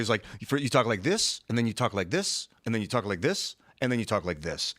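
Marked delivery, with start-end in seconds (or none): 0.4-3.6 each "this" lifted in a rising tune; Canadian tilt. Retold or imitated. Quoting a comedian on Canadian talk, rising on each "this". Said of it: "he was saying the Canadian tilt". 3.8-4.7 "this" falling low to close; downward ending. Retold or imitated. Showing it's their turn to talk, "this" falling. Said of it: "when you go down at the end".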